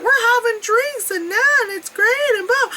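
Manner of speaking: high, breezy mock voice; mocking relaxed adults. Relaxed adults sipping drinks at the top, high breezy mock. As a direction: play smug carefree tourists. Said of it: "the adults are just like".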